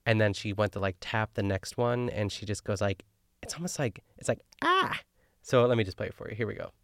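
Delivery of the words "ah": sudden high-pitched mock shriek; Ina Garten scream. Imitating the host's startled chili-powder scream, high mock shriek. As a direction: yelp like a startled cook overdoing the chili. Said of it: "It's like when she screams".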